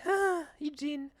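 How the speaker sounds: sweet prim lady voice; mock saintly tone; imitating Katrina. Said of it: "Katrina's like".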